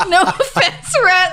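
high, slow, laughing echo; mock repeat. Friend echoing the line at the table, high and laughing. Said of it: "I love that".

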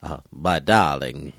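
slow, lightly voiced old Hollywood lady; a touch of Katharine Hepburn; Katharine Hepburn impression. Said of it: "She does".

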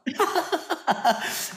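a bright high burst of laughter. Real, not acted. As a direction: laugh it off, amused at himself. Guest laughs at own story.

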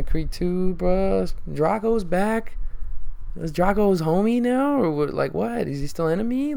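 high, loud and fired up; gushing about the sequel, getting worked up; excited. Real, not acted. Vlogger raves to viewers about Drago returning, high and excited.

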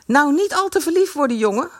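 family heckling fat Kees, jeering. A narrator's reading. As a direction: taunt him as he leaves. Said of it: "hoonden de leden van de familie Brummer".

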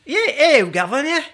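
a quick mock Cockney "hey, governor"; cockney accent. Answering the governor accent question, a brief mock Cockney greeting. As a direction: toss off a jokey Cockney greeting. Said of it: "hello, governor accent".

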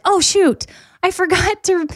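retold high-pitched "just remembered" alarm; sudden realization. Retold bedtime moment of remembering.

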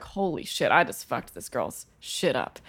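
low, hushed, slow aside to yourself; retold quiet dismay. Bartender recalling following the customer to the bathroom, quietly dismayed. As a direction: replay the sinking realisation under your voice. Retold horror after spilling drink.

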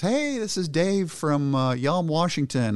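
high, slow, friendly caller. Retold or imitated. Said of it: "This guy goes".

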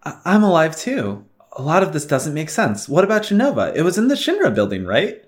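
game character voice. Acted. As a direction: read the character lines in character.

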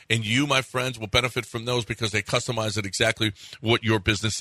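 casual live radio ad read; sports host reading a car dealership ad. Acted. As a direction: pitch the dealership like a chatty radio spot. Host reads sponsor ad.

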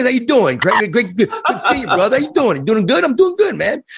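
thick New York accent, high-pitched and excited; Long Island accent. Shown as a demonstration. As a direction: put on a fired-up Long Island guy greeting a buddy. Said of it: "I could talk like this".

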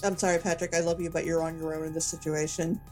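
usual speaking voice

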